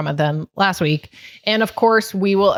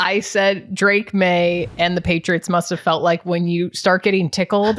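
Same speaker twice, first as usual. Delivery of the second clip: usual speaking voice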